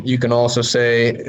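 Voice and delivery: ordinary talk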